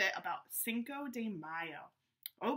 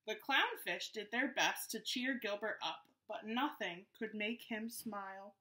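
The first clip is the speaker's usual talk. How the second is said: story narration. A narrator's reading. Reads the story aloud.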